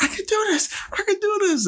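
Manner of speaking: very high, squeaky mock resolve; imitating Nicole rallying. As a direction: pump yourself up in a falsetto. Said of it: "then she's like".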